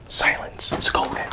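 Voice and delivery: soft whispered savouring; hushed, barely voiced; hushed aside. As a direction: whisper it to relish the quiet. Savouring quiet while kids sleep.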